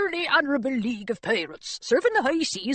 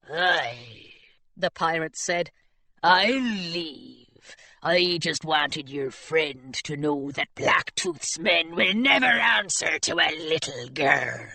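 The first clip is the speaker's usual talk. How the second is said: low, slow gruff pirate; scornful low pirate voice; 7 semitones lower, slower than usual. Acted.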